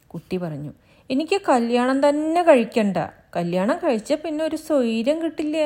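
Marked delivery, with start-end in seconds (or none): 1.1-5.6 put-on high, loud child's voice; chattering child, raised pitch; little child. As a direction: tell it like an eager little child. Child quotes the bride.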